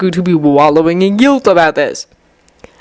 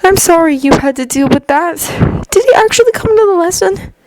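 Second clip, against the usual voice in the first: put-on gentle young man voice; 12 semitones higher than usual. A narrator's reading. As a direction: voice Izuku lightly higher, kindly. Izuku voiced by narrator.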